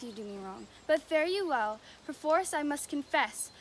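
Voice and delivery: normal talking voice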